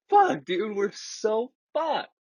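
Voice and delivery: high, drawn-out dismay; mock panicked whine, dragging the words out; retold panic. Retold panic at being pulled over.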